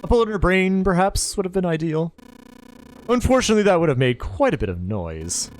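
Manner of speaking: a lightly voiced villain savoring a memory; lightly voiced calm relish, lingering on the details. Ace describes stabbing Clover to Snake, lightly voiced relish.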